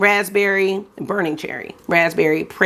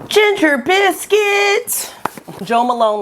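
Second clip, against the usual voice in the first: grand announcement; 11 semitones higher, slower than usual. Real, not acted. Announcing the next perfume.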